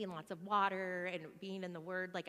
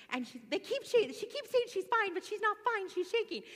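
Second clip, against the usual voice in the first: put-on much higher, louder, childlike worried pleading; high kid voice; 13 semitones higher, 7 dB louder than usual. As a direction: play the scared boys begging Aunt Trissa for help. Kids on phone to Aunt Trissa.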